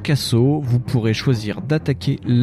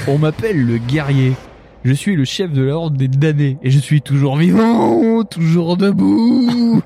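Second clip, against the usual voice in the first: raspy sepulchral voice; 4 semitones higher, 4 dB louder than usual. Acted. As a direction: rasp it out like a grim horde chief. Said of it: "D'une voix rauque et sépulcrale".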